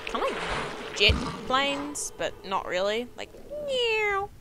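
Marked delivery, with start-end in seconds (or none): 3.7-4.3 a high mock meow, half-hearted; bad snail impression. Gamer mocks the snails' sound, silly high meow. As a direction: lightly squeak a meow like a snail. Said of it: "that was a really bad impression of a snail".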